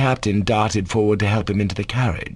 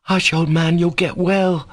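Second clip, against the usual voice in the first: put-on high, brusque boy's reassurance; angry-sounding; 9 semitones higher, 3 dB louder than usual. A narrator's reading. Schoolboy tells his sick friend he'll recover, angrily. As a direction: hush him crossly to hide feeling. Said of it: "in a voice that sounded angry".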